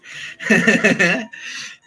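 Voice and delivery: long, loud, high laugh; real laugh. Real, not acted. Comic after getting justice, laughing out loud. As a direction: burst out laughing at your own bit.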